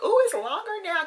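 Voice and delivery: high, loud delighted squeal; delighted surprise. Retold or imitated. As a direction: squeal it at the mirror, thrilled. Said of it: "I'm like".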